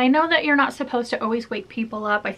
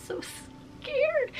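high, soft mock-tearful whimper; imitated crying; 11 dB quieter, much slower than usual. Waking from a night terror, imitated crying. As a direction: squeak it out as if about to cry. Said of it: "I always immediately wanna cry".

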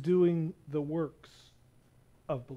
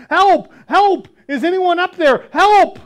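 high, loud cries for help; calling up a canyon wall for rescue; desperate yell for help; 11 semitones higher, 13 dB louder than usual. Acted. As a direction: shout up the cliff in panic. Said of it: "Jack began yelling for help".

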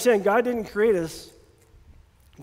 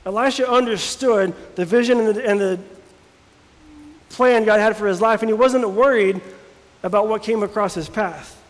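louder preaching with rising fervour; full-force preaching; 6 dB louder than usual. Acted. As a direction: preach it out to the congregation.

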